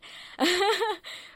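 a bright burst of high laughter; real laugh. Real, not acted. Panelist laughing at her own joke, bright high laugh. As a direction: let a real laugh break out.

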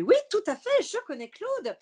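high, loud burst of enthusiasm; over-eager reply. Retold or imitated. Acts her eager on-air reply.

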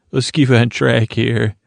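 ordinary talk

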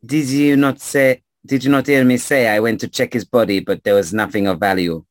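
put-on questioning in a French accent; French-accented storyteller querying what was heard. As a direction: challenge the listener with a French accent. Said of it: "stranger with a French accent".

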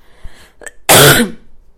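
harsh loud coughs; coughing fit. Real, not acted. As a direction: cough hard into the mic. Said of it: "Sorry about all the coughing".

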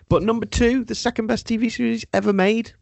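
high-pitched questioning disbelief; incredulous. Real, not acted. Host doubting the show's number two ranking, high and incredulous.

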